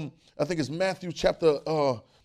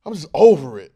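loud, exasperated fed-up burst; exasperated Jesus; 15 dB louder than usual. Retold or imitated. Preacher voicing Jesus fed up with disciples, exasperated. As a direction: blurt it like someone totally over it. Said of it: "seems like he's just".

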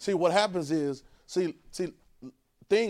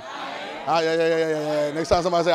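ordinary talk, nothing marked

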